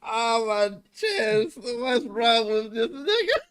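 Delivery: barely able to breathe from laughing; laughing till crying. Real, not acted. Friend reacts to the wild clip, breathless cry-laughing. Wheezing after a shocking clip.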